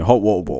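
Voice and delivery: mock "Hot water bowl" as mushy vowels without Ts; imitating co-host's pronunciation. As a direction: run "Hot water bowl" into bare sounds. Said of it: "all that sounds like to me".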